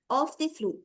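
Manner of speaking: plain reading voice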